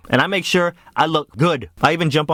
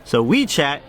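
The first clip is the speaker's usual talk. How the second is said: normal talking voice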